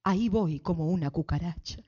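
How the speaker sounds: low, soft, defeated sigh-voice; weary congregant. Retold or imitated. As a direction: drag it out like a worn-out sister. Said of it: "Hermana, ¿cómo está?". (hermana cansada, voz baja y abatida)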